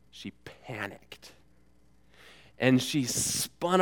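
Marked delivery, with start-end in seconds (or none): 0.1-1.3 slow whispered "she panicked"; hushed suspense. A narrator's reading. Preacher builds suspense in the story, whispering.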